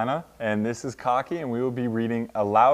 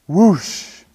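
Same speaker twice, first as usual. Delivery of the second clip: high, loud rushing whoosh; 7 semitones higher, 11 dB louder than usual. A narrator's reading. Narrator voicing Tortoise's sudden slide, loud whoosh. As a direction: burst out with a swooping whoosh. Sudden sled noise in story.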